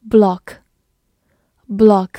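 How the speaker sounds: block with a British short rounded o; British "block", short o as in British "hot"; British pronunciation. Shown as a demonstration. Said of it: "美式发音读作block".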